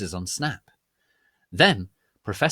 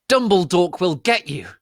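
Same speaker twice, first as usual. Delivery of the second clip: loud hammy shout; 9 semitones higher, 9 dB louder than usual. A narrator's reading. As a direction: bellow it like a stage villain. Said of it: "Draco shouted".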